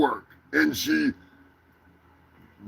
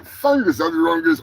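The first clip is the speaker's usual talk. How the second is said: normal talking voice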